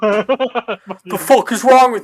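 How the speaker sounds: high, loud, slow yell; hammed-up mechanic dad rage; mock furious dad. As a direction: bark it like an angry father. GM imitates angry dad.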